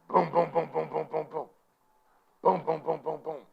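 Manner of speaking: rapid high booming thuds; imitated door pounding. Storyteller imitating police knocks on the door, rapid and percussive. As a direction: pound out the booms evenly, like a fist.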